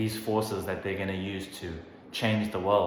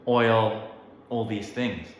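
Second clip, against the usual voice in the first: normal talking voice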